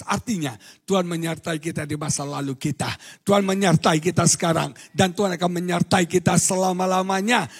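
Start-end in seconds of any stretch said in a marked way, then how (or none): 3.2-7.5 loud rising proclamation; preaching at full force. Acted. Preacher proclaiming God's presence over music. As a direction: proclaim it to the whole church.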